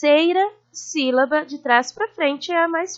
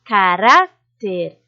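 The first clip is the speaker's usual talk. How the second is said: caráter slowly, with a long, lower-pitched rá; lengthened stressed syllable; 5 semitones lower, 4 dB louder, much slower than usual. Shown as a demonstration. Teacher showing the penultimate is stronger, lingering on rá in caráter. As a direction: stretch the middle syllable of caráter. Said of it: "a sílaba mais forte a gente se demora".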